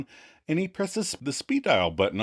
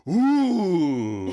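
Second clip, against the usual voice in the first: higher, louder ooh of comfort; a sinking-into-the-chair ooh; hammed-up relaxed sigh; mock pleased ooh; 5 semitones higher, 4 dB louder than usual. [name] reclined in chair.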